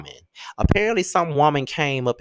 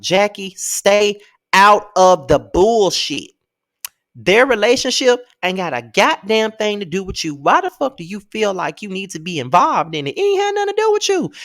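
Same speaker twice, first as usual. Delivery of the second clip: louder and higher, scolding Jackie; angry rant; 5 semitones higher, 5 dB louder than usual. Real, not acted. Venting at Jackie over her meddling, angry and loud. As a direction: really tell her off. Ranting at a cast member.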